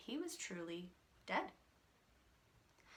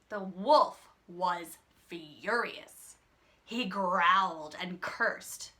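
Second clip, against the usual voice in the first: menacing narration; 3 semitones lower, 6 dB louder, slower than usual. A narrator's reading. Narrating wolf's fury.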